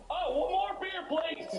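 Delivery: squeaky voice breaking into laughter; high giggling order for a beer; high, laughing mock voice. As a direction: pitch way up, laughing through it. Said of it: "and he's like".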